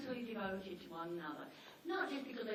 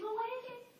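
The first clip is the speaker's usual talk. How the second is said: much higher voiced postman; puzzled postman; 13 semitones higher than usual. Postman puzzled at the door, higher voice. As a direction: play the postman, baffled. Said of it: "and says".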